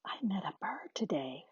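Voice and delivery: put-on quiet little girl's whisper. A narrator's reading. Quiet girl nobody can hear, whispering. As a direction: whisper it softly, barely audible. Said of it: "whispered quiet words".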